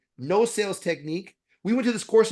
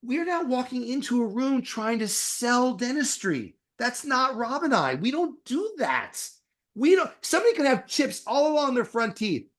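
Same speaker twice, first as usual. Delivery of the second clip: higher, slower, pressing every point; emphatic retort; 6 semitones higher than usual. Retold or imitated. Dentist retelling what he told course trainers, emphatic and raised. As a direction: lecture the trainers, half-acted, slow and high. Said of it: "I go".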